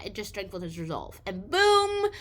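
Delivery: ordinary talk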